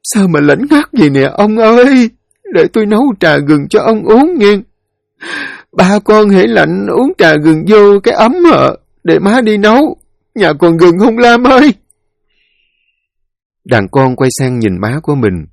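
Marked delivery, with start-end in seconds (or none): 0.0-11.7 high, keening voice of a dazed widow; plaintive and high, lost in denial; voice falling into emptiness. Acted. Widow talking to her dead husband and children, lost and plaintive. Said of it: "giọng nói như rớt vào khoảng không".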